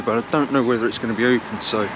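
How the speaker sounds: normal talking voice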